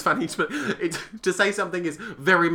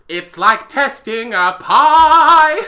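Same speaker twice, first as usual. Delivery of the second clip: slow, laughing, much louder; 9 semitones higher, 10 dB louder, much slower than usual. Retold or imitated. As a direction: quote lyric through laughter. Quoting a grim song lyric.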